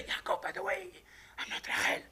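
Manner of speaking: a coy whisper to the husband; mock confession. Leah to Yaakov, the confession she never made: coy whisper.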